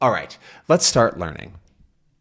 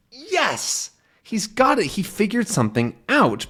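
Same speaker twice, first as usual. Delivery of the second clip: high, pleased exclamation; a touch of excited yes; 10 semitones higher than usual. Acted. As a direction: show the joy of discovery. Explaining cheer, voiced.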